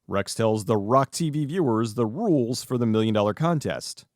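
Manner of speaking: ordinary talk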